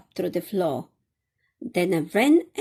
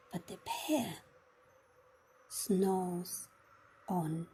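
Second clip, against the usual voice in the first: soft and low, don't wake him; hushed refrain; 3 semitones lower, 12 dB quieter than usual. A narrator's reading. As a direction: drop to a near whisper for the sleeping bear. Sleeping bear refrain, hushed.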